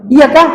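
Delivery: short rising "i-ya-ka" for iyaka; wrong light y. Shown as a demonstration. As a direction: toss off iyaka as a brief question. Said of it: "Bukan begitu ya".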